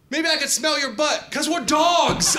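high, loud, building to a drawn-out shout; over-eager outburst. Acted. Comedian trying to befriend a stranger as a fellow dog, over-eager shouting. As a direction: blurt it out with far too much enthusiasm.

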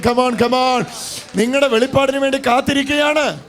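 high, loud revival exhortation; full-force preaching. Acted. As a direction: rouse the congregation with a rising cry.

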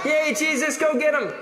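high, excited crowd cheer. Shown as a demonstration. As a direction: cheer him on for the kids to copy. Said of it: "give me an excited cheer".

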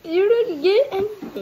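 loud, high protest. Real, not acted. Arguing over the game result.

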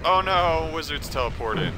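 normal talking voice

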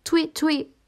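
high chirpy mock whistle; whistle imitation. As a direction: copy a police whistle with your voice.

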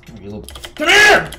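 high, loud chase yell; sudden high-pitched shout; frantic shout. Real, not acted. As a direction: yell after someone getting away. Chasing Billy in the game.

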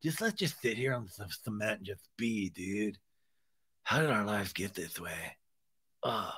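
low, soft mock despair; hammed-up gloomy bro; dejected. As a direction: sigh it out, utterly defeated. Acting despondent Sandoval sitting down.

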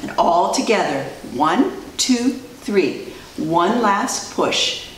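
ordinary delivery, nothing marked

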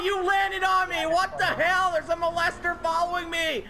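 shouting. Real, not acted. Yelling after being landed on.

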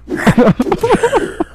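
very high squealing laughter; high-pitched laugh. Real, not acted. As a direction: crack up in a squeal. Laughs at own bit.

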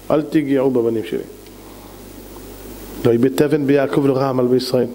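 low, measured restraint; calm them down, pitch dropped low; acted gentle authority; calming protective command. God restrains angels, after heated accusation.

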